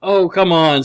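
ordinary delivery

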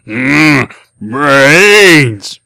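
loud groaning zombie brains; zombie moan. Acted. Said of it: "in my hungriest zombie voice".

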